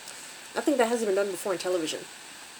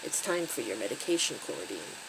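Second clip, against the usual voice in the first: low, quiet, emotionless delivery; monotone; 7 semitones lower, 7 dB quieter than usual. A narrator's reading. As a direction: say it tonelessly, dropping low at the end. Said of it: "saying in a monotone".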